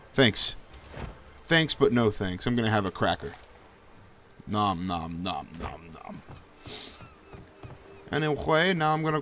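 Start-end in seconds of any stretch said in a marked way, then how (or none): 4.5-6.2 soft, low nom nom eating noise; mock munching. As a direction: pretend to munch a cracker quietly. Eating a cracker.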